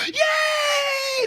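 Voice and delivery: high squealed cheer; imitated crowd cheer. Crowd wakes up for the band, squealed cheer. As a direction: mimic an excited crowd whooping.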